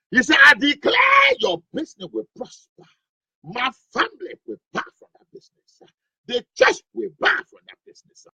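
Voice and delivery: rising preacher declaration, building to a shout; preaching declaration. Acted. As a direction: proclaim it like a fiery pastor. Pastor prays over business.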